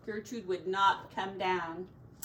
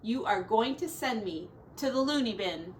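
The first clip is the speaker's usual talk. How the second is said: plain reading voice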